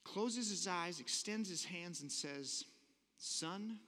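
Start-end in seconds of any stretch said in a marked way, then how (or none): 3.2-3.8 softer, gentle pronouncement; a hushed, tender blessing. Retold or imitated. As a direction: say it gently with eyes closed. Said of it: "takes a deep breath, closes his eyes".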